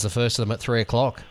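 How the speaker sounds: ordinary delivery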